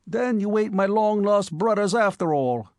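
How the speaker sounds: Bowery youth feigning regret at the cabmen; mock regretful. A narrator's reading. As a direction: pretend to be let down. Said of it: "said the newcomer regretfully".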